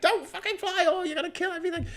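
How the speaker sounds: very high shrill mock nagging; mock shrill campaigner voice; shrill preachy activist. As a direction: squeal it like a hectoring eco-warrior. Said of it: "without being too, like, you know, shrill".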